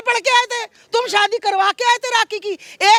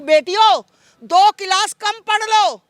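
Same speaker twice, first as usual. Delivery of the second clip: slow, measured speech to the camera; slow solemn appeal; slower than usual. Real, not acted. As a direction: spell out each line slowly like a public appeal. मैं आपके channel के माध्यम से कहना चाहती हूँ.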